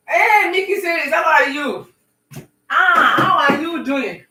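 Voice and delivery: loud, high, drawn-out hello to a viewer; excited greeting. Real, not acted. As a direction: call out happily to a friend who just joined. Greeting a viewer joining live.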